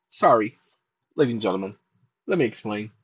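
deep late-night host voice; deep host persona. Acted. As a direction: apologize to the ladies and gentlemen in a deep, cool voice. Host persona explains again.

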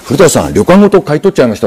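ordinary delivery

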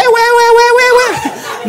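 high, slow and stretched wait; playful high drawn-out wait; high slow mock voice. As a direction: draw it out in a squeaky voice. Comic mock baby reaction.

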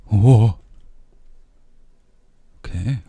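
ordinary talk, nothing marked

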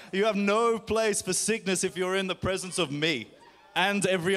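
fervent, commanding prayer over the room; healing prayer. Acted. Pastor praying over the congregation, a firmly commanding healing prayer. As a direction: declare the healing with steady conviction.

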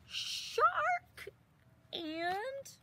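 high mock cry of alarm. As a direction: squeal shark like a frightened child. Mock-alarmed reading of shark.

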